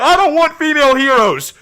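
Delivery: loud, high mock protest; mock complainers. Streamer mocking people who don't understand, in a loud high yell. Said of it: "They're always like".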